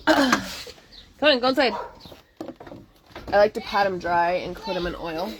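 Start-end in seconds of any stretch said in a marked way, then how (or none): 0.1-1.7 loud aside to the dog; calling the dog. Real, not acted. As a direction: call the dog in. Calling dog inside mid-lesson.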